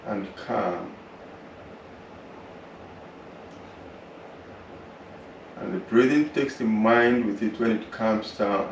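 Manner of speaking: calm, unhurried relaxation voice; guided meditation. Acted. Yoga teacher guiding students through relaxation, calm meditation voice. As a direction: guide the class gently through the body.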